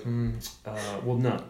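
imitating an earnest, pondering interviewee; straight-laced deadpan. Crow breeder answering the interviewer, straight-laced and pensive. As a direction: think it over with mock seriousness. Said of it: "he's doing it straight-laced".

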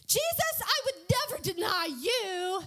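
high, hammed-up earnest vow; Peter's avowal. Hothead disciple vowing loyalty to Jesus, high impassioned voice. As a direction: declare it high-pitched with mock fervor. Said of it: "Peter said this".

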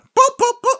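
high squeaky boop, boop, boop; Mario pipe sound. Retold or imitated. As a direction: chirp it out like a cartoon pipe warp. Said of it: "I can't do it that well".

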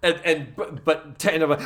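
usual speaking voice

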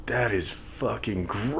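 slow, hushed disgust; retold disgust. Surgery observer recalling the fat, slow quiet disgust. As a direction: drag it out softly, appalled.

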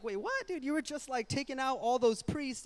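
high, disbelieving outburst; incredulous. Retold or imitated. Preacher reacts in disbelief.

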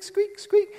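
high squeaky car noise; mimicked brake squeak; squeak imitation.

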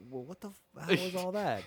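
high, bewildered question; puzzled Michael impression. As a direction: ask like you've lost track. Michael asking about the doves.

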